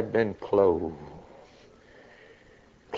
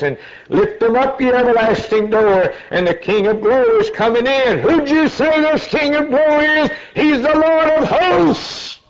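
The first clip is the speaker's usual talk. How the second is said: preaching climax; 12 semitones higher than usual. Real, not acted. Preacher calling the gates open, high climactic shout. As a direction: drive it to the peak.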